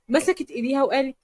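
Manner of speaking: ordinary delivery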